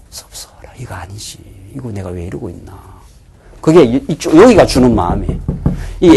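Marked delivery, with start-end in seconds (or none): 0.1-3.0 hushed, unvoiced whisper of a troubled conscience; sermon's imagined inner voice, whispered softly. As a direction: whisper it to yourself like a guilty thought. Preacher voices his own troubled conscience.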